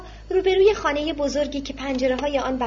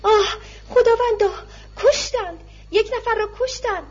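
high, loud wail with sobs in it; a woman crying out in horror; crying scream; 8 semitones higher, 3 dB louder than usual. A narrator's reading. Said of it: "زنی که از حیاط مجاور بیرون آمده بود فریاد کشید". (جیغ زدن همراه با گریه)

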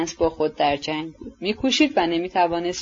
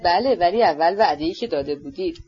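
plain reading voice

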